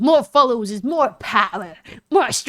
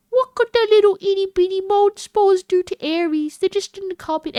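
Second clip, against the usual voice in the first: high, cutesy put-on voice; sweetly oblivious, pitched way up; mock naive innocence; 10 semitones higher, 3 dB louder than usual. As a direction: play dumb in a tiny high voice. Acts out own past naive dismissal.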